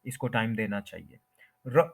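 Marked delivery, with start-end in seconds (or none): 1.7-1.8 "र" as a very short ruh; short schwa. Shown as a demonstration. Teacher showing the weak syllable, short schwa sound. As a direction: clip "र" to the briefest schwa. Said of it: "shortest possible".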